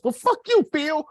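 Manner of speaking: sudden high, loud retort in an acted blowup; mock furious outburst at a coworker; angry comeback. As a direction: fire it back at him as if insulted. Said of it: "And then Antonio goes".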